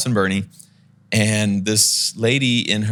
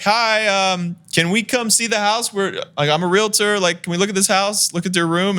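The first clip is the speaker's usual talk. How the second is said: high, careful polite voice; high polite asking to see the room; polite door call; 10 semitones higher than usual. Retold or imitated. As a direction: call through the door nicely, pitched up. Calling through locked door.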